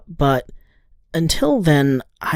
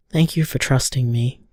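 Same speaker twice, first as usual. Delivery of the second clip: tender; 5 semitones lower than usual. Acted. Tender goodbye on voicemail.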